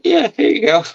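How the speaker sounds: high, casual voice for his friend; friend's breezy put-on voice; imitating Bill. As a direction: toss it off like handing over a phone. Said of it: "He's like".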